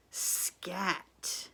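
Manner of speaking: usual speaking voice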